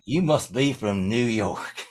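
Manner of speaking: slow imitation of a Southern driver; South Carolina driver. Tow truck driver sizing up the musician, slow Southern impression. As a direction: stretch it out like a small-town tow driver. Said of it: "tow truck driver comes in from South Carolina".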